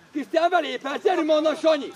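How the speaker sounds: loud sideline instructions; urging shout. Real, not acted. Coach urges players on during play, urging shout. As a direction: shout them on across the pitch.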